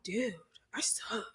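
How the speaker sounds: breathy whisper of self-doubt; whispered thought. Retold or imitated. Guest recalling her nervous inner thoughts, whispering. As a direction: whisper it to yourself.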